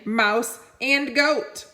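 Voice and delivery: plain reading voice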